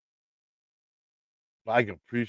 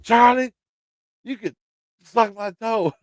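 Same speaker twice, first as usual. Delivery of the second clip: much higher, mock wife's voice, teasing; imitating his wife; 10 semitones higher, 5 dB louder, slower than usual. Wife teasing Charlie after the prank, higher mock voice. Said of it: "She said".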